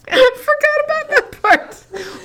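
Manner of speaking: cracking up; laughing. Real, not acted. Host cracking up at the movie reference.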